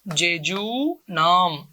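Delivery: जेजूनम stretched very slowly, syllable by syllable; slow drill. Shown as a demonstration. Teacher drilling the class on the term, very slowly. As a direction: drag जेजूनम out so they catch it. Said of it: "क्या बोलते हैं बेटा?".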